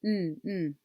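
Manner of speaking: short hummed N, twice; just the N sound; final sound alone. Shown as a demonstration. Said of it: "What's the final sound you captured?".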